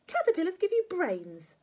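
high, loud granny pronouncement; lecturing grandma. Acted. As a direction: insist as a batty old woman.

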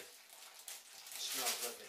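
slow, savouring repeat. Real, not acted. Pipe smoker savours the tobacco aroma, slow and savouring. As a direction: let it linger, slowly.

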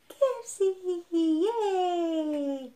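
high, loud, gleeful yay; excited squeal. Real, not acted. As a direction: squeal with delight. Announcing mukbang food.

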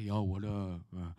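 low tough-guy gangster voice; hard gangster. Retold or imitated. Gangster walking into church introduces himself, acting hard in a low voice. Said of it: "they would try to act all hard".

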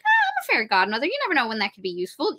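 fast, breezy excuses; hurried chirp. Retold or imitated. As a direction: rattle it off like a fussy fairy. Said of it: "she's like".

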